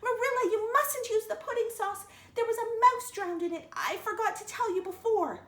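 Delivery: high, loud shriek of alarm. Retold or imitated. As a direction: blurt the warning out in a panic. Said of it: "I shrieked out".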